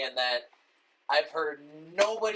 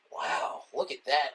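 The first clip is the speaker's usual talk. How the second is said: Owen Wilson; 2 semitones lower, slower than usual. Retold or imitated. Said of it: "He looks like".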